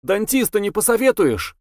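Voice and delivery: calling after. Acted. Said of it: "существо окликнуло его".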